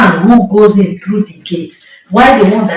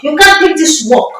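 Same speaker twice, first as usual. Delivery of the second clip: pitched up high on the repeat; raised repeat; 7 semitones higher than usual. Retold or imitated. Spirit's warning in the dream, pitched up high. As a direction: push the warning up higher.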